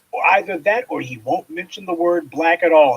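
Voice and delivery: ordinary talk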